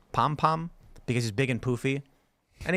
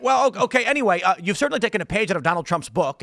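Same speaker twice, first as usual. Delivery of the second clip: high, rattled mock reporter changing the subject; hammed-up cornered reporter; flustered journalist; 6 semitones higher, 4 dB louder than usual. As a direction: stumble then push on, pitched up. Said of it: "He's like".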